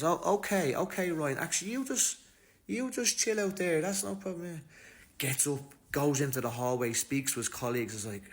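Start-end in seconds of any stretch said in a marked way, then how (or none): 0.0-4.6 much higher, soothing backpedal; smooth it over nervously; sudden placating. Retold or imitated. Cop suddenly placating Ryan after realising he was shot.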